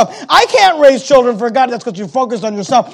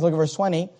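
ordinary talk, nothing marked

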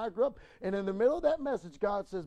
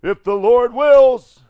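slow, booming, drawn-out declaration; opening line roared to the congregation; loud slow proclamation; 7 semitones higher, 21 dB louder, much slower than usual. Acted. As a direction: preach it out slow and big.

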